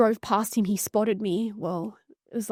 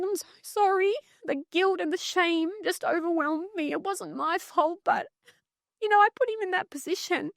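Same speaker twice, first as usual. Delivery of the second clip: real overwhelmed guilt, voice pitched high; high, strained with remembered guilt and shame; emotionally activated; 8 semitones higher than usual. Real, not acted. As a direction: relive the apology, voice climbing with emotion. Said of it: "my nervous system's becoming activated right now".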